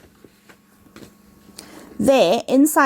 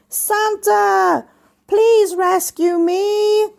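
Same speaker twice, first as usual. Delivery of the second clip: higher, slow plaintive plea; pleading sign; 8 semitones higher, much slower than usual. A narrator's reading. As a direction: draw it out high like a plea. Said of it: "writing in the snow that said".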